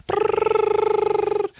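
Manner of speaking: very high, long trilled brrr; imitated machine noise. Imitating the scanner's readout, high mechanical trill. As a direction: mimic a screen filling up. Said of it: "It's like".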